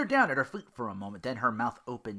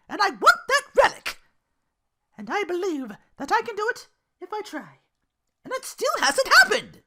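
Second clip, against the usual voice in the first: much higher, louder, fierce resolve; Belle determined; 10 dB louder than usual. Acted. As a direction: build to a defiant vow.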